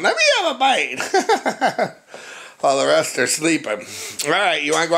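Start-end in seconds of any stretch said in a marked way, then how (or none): 0.0-1.0 much higher voice through a laugh; puppy voice. Retold or imitated. Owner voicing a puppy begging for a bite, laughing. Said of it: "she's going". 1.1-1.9 high laugh. Real, not acted. Laughing at own puppy voice.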